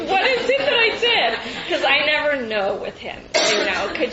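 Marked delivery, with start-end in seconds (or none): 0.1-1.4 high, loud, laughing curious question. Retold or imitated. Asking her dad what she did, high and loudly curious. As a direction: ask it with amused curiosity. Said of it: "I was really curious".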